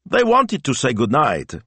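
high-pitched daughter voice; lifted, lilting character voice; explaining daughter. A narrator's reading. Daughter explaining to her papa.

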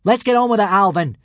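high, loud, impatient call. A narrator's reading. Hiccup urges Alvin to begin, calling out. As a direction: call up to someone at a distance. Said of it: "Hiccup called out".

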